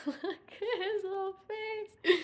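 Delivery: high, slow coo through laughter; laughing coo. Real, not acted. Pet sitter squishing the cat, laughing baby talk. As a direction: giggle while doting on him.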